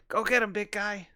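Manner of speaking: a touch of a goblin hype man; lightly voiced egging-on goblin. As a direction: send the big guy in, lightly acted. Goblin cheers on big ally.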